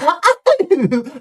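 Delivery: high, loud girlish giggle impression; imitated little laugh. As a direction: mimic her squealing little laugh. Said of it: "her little laugh".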